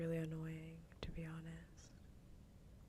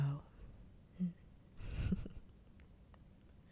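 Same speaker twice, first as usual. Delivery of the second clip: breathy, hushed chuckle. Real, not acted. Amused while chatting to viewers.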